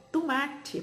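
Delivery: "tomate" as "tumátchi"; spoken pronunciation. Shown as a demonstration. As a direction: say "tomate" with "tu-" and final "tchi". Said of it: "porque ela fala tomate". ("tomate" falado "tumátchi")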